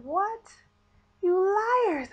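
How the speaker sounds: very high, loud mock outrage; outraged disbelief. Patient waking in recovery accuses staff, outraged disbelief. Waking to find she was tricked.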